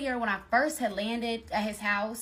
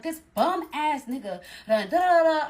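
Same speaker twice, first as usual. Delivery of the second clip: put-on loud drunk rant; 5 semitones higher, 6 dB louder than usual. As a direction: rant about him to your friends. Ranting to friends, drunk.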